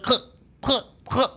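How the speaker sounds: high clicking noise; imitated clicking. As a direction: mimic a broken button click. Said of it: "it was like".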